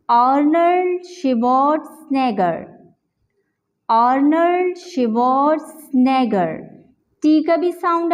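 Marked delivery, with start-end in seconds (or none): 0.1-6.5 आर्नल्ड शिवार्ट्जनेगर said whole and slowly, T, G and N heard; full slow pronunciation. Shown as a demonstration. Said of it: "अब हम इसे पूरी तरह से pronounce करते हैं". (आर्नल्ड शिवार्ट्जनेगर पूरा, धीरे, साफ़)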